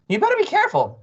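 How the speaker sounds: high, loud warning; warning Mrs Twit. A narrator's reading. Wife warning her plotting husband, high and loud. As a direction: put on a high-pitched threatening wife.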